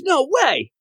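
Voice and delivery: high, startled no way; stunned disbelief. Retold or imitated. Aquaman hears Superman can't talk to fish, stunned disbelief. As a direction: gasp it out like he can't believe it.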